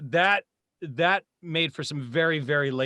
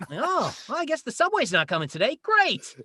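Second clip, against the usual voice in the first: in-character warm-up; 11 semitones higher than usual. Shown as a demonstration. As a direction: grumble in character, pitched way up. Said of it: "You know, things like that".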